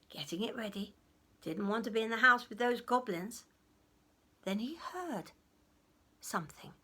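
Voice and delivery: ordinary delivery, nothing marked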